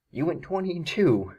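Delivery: high-pitched, incredulous build to the score; mock disbelief. Video host voicing a losing player's bewilderment, incredulous. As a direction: exaggerate how amazing that score is.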